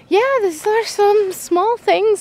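ordinary delivery

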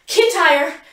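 high, loud shout; alarmed cry. A narrator's reading. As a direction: cry out his name. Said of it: "I shout".

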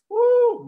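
high, loud "woo"; excited whoop. Real, not acted. Host whooping over the long-awaited day, excited whoop. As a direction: let out a sudden high whoop.

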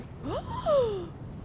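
high-pitched, drawn gasp; sharp delighted intake of breath; excited gasp. Real, not acted. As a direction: gasp high at a sudden discovery. Reacts to character on screen.

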